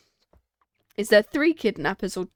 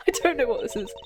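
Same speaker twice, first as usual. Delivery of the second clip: usual speaking voice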